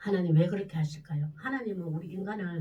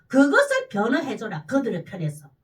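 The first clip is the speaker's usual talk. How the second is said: emphatic charge; 9 dB louder than usual. Acted.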